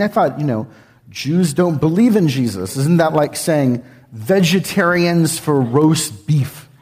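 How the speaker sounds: ordinary delivery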